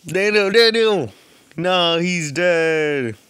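loud cry of alarm, slowed at the end; shouting in dismay. Real, not acted. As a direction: yell his name then wail the news. Character dies in game.

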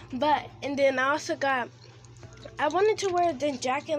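ordinary talk

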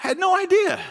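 hammed-up disbelief; mock surprise. Preacher joking God is shocked by prayer, mock surprise. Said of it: "he's not like".

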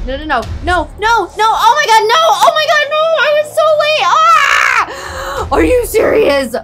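high, loud panicked screaming; loud cry of dismay. Real, not acted. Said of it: "screaming and crying".

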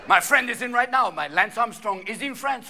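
usual speaking voice

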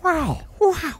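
high, drawn-out Owen Wilson "Wow". Retold or imitated. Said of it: "Owen Wilson meme".

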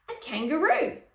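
high, loud storybook yell; acted outcry of disbelief; astonished yell. A narrator's reading. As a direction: yell it in shocked surprise. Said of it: "yelled the dancers".

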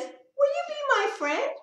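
very high hopeful plea; tiny mouse asking brightly; squeaky eager question. Acted. Mouse asks the other mouse.

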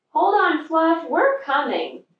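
reach them from far off; calling out. A narrator's reading. Little Bear calls to Fluff, hearty shout.

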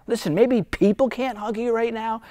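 usual speaking voice

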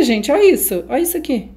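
ordinary delivery

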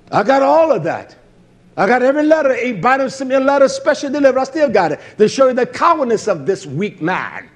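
loud, high, driving sermon voice; preaching at full force. Acted. Preacher railing at his accusers before the congregation, loud and rising. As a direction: hammer it home from the pulpit.

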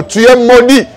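ordinary talk

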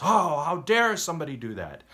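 high, loud mock outrage; outraged David. David outraged at the rich man stealing, high and loud. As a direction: play up the indignation. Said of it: "he goes".

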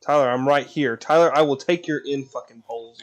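loud call across the game; yelling for a teammate. Real, not acted. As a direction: shout to get his attention. Calling out to Tyler in game.

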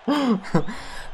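high, soft giggle; amused laugh. Real, not acted. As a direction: giggle as you wrap up. Laughing after the win.

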